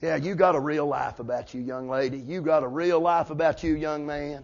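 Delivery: low, soft, dry mockery; quiet sarcasm. Real, not acted. Preacher to young people living double lives, quiet sarcasm. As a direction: drop low and soft with mock approval.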